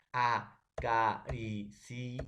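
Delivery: slow syllable split. Shown as a demonstration. Student syllabifies word.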